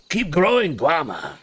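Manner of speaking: low, slow gruff officer; commander voice. Acted. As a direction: growl it like the commander. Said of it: "I'm just doing my commander impression".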